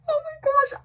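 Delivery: very high, loud squeal; high-pitched cringing wail; mortified. Real, not acted. Mortified over her own blunder.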